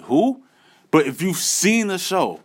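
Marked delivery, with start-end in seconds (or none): 0.0-0.4 confused who. Retold or imitated. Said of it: "you're gonna be like".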